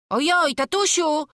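high, loud cry to father; acted dismayed yelp; alarmed cry. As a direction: call out in sudden alarm as a boy. Son discovers boy is gone.

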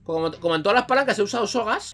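usual speaking voice

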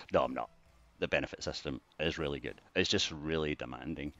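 ordinary talk

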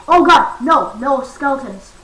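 loud, panicked no's; real alarm at skeletons; alarmed shout. Real, not acted. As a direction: cry out as skeletons show up. Skeleton appears.

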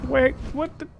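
sharp high wait, what the; jolted, cut off mid-question; surprised. Real, not acted. Gamer surprised by another attack, sudden high blurt.

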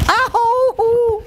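high, drawn-out "ow"; pained cry. Acted. Princess lying on her stomach, high pained cry. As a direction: yelp it long and high.

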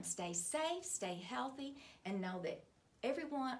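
plain reading voice

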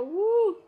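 high, loud "woo!"; excited whoop. Retold or imitated. Fan with an hour to go, excited whoop. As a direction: let out a celebratory whoop.

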